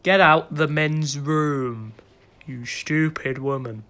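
drawn-out mock command; slow, stern order. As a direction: spell out the order very slowly. Slow order to woman intruder.

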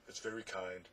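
soft, subdued thanks; mildly groaned, dropping away quietly; groaning. A narrator's reading. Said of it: "groaned the Din".

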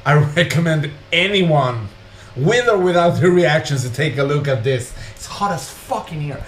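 ordinary delivery

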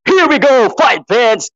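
high, punchy commentator cry; excited kickoff call. Real, not acted. Commentator to fight fans as the bout begins, excited call.

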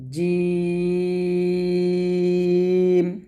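জিম held very long and slow; drawn-out jim. Shown as a demonstration. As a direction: stretch জিম out at length. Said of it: "আমার সাথে আপনারা পড়েন".

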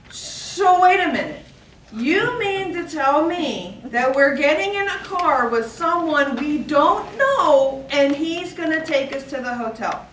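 high, loud and slow, disbelieving; a boy aghast at riding with strangers; incredulous kid. Retold or imitated. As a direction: spell out the absurd plan in shock. Son's alarmed disbelief retold.

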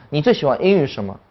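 ordinary delivery

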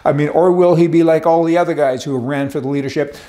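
usual speaking voice

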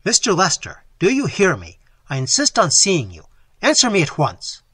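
loud commanding call through a door; loud, determined call. Acted. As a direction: demand an answer, firm and loud. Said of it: "he called out in a loud, determined voice".

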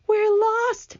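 high-pitched cry of despair. A narrator's reading. Miss Spider as the crash approaches, crying out in despair. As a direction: cry it out high, as if all is lost. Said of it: "Miss Spider cried".

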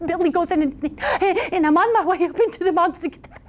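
gasping, high, stammering voice; frightened goat. Acted. Second goat answering the troll, nervous high stammer. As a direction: quaver it out, stuck on the b's.

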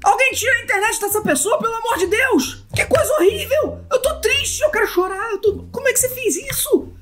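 very high, frantic mock wailing; shocked distress. Real, not acted. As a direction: shriek in horrified disbelief. Outraged at pet killed in build. (choque desesperado, bem agudo)

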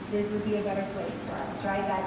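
ordinary delivery

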